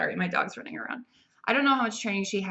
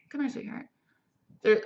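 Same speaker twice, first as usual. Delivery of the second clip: sweet talk to dog; 4 semitones higher than usual. Real, not acted. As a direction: call the dog over gently, voice lifted high. Talking to her dog mid-review.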